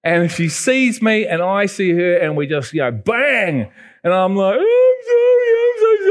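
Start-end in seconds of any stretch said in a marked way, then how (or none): 4.6-6.0 high, loud crying apology; tearful child apology. Retold or imitated. Lost boy to his mother on the beach, high loud crying. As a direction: blurt it out sobbing like a small boy.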